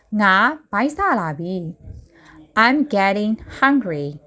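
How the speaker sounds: ordinary talk, nothing marked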